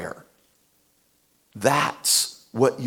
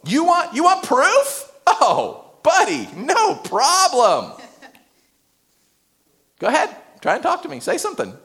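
taunting; 11 semitones higher than usual. Acted. Preacher voices Gabriel's taunt.

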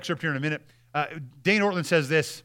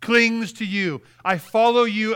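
slow and louder, clinging to each word; a slow, swelling cry of devotion; fervent devotion; 6 semitones higher, 6 dB louder, slower than usual. Acted. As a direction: pour longing into my soul clings. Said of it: "What it really means is".

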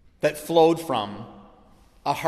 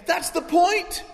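high, loud, urgent pleading; leaning over the table, insisting he see it; retold frantic excitement; 10 semitones higher, 3 dB louder than usual. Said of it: "And I was going crazy".

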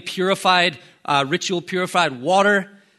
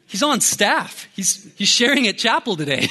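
high-pitched, laughing protest; insistent protest; 6 semitones higher than usual. Retold or imitated. Defending his buddy to the old lady, high comic insistence.

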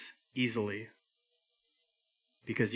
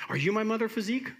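high-pitched, searching bird voice; little bird; 10 semitones higher, 3 dB louder, faster than usual. Acted. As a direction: climb high on the question like a lost chick. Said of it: "this little bird who falls out".

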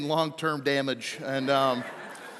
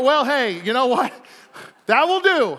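mock cheerful resignation; 5 semitones higher, 6 dB louder than usual. His own thought retold.

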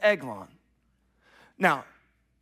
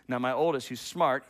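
usual speaking voice

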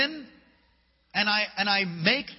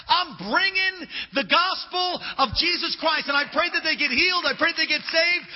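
loud, higher preaching at full force; fiery preaching; 8 semitones higher, 4 dB louder than usual. Acted. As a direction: drive the conviction home.

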